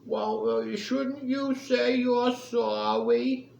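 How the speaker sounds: high, drawn-out lisping whine; exaggerated lisp, high and stretched out; mocking lisp. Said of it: "he has this lisp".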